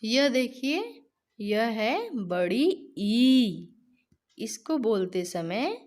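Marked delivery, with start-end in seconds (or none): 1.4-3.7 बड़ी ई slow, long ई held and stressed; teacher stretching the long ई. Shown as a demonstration. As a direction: draw out the ई in बड़ी ई. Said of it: "ई करते समय इस पे ज़्यादा जोर दिया".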